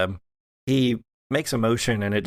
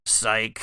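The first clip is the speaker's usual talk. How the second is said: mocking laugh. Father NPC taunts after fake death.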